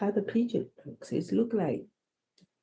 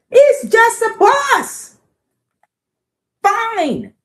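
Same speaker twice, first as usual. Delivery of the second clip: very high, loud, slow huffy bird voice; pigeon scoffing; 10 dB louder, slower than usual. Acted. Pigeon scoffing that it's only a bus. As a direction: huff it out, offended.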